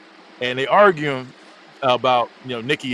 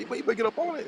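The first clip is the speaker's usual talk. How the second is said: retold urging; 10 semitones higher, 7 dB quieter than usual. Quoting friend urging him to dance.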